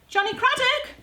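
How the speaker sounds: high, loud call of a name. Real, not acted. Vlogger calls for Johnny Craddock mid-chopping, calling out. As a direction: holler the name out, pitched high.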